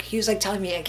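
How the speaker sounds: ordinary delivery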